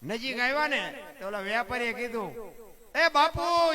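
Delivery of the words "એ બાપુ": high, loud hail to a rider; calling out. Retold or imitated. Merchant hailing the Bapu on his mare, calling out. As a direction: call him over from afar.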